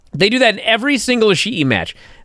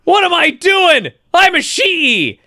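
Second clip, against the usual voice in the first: mock indignant Ishii; 10 semitones higher, slower than usual. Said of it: "Ishii's like".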